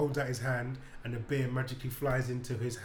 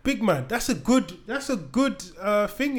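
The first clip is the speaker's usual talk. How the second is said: higher and louder, pleading the idea; retold eager pitch; 7 semitones higher, 5 dB louder than usual. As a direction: sell it hard to a sceptical mate. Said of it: "I was like".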